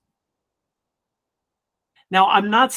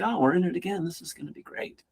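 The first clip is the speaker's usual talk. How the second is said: unbothered, easygoing calm, much softer; relaxed veteran; 9 dB quieter than usual. Retold or imitated. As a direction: play it cool like a veteran sipping a martini. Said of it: "they're just like".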